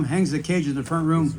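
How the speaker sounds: ordinary talk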